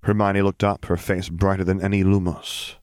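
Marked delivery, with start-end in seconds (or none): none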